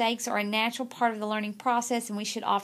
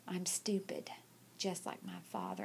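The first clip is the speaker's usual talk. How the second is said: whispered, barely audible, much softer; a beaten boy mumbling it under his breath; whispering a forced confession, ashamed; 3 semitones lower, 12 dB quieter than usual. Retold or imitated. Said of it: "Say it like you mean it".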